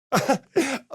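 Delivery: bright amused laugh up high; short laugh. Acted. Companion laughing at the hero's remark, short laugh. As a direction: laugh it off lightly.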